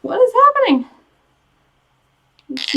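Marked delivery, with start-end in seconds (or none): 0.1-0.9 sudden high startled question; puzzled, voice jumps up; surprised. Real, not acted. Mascara tube stuck.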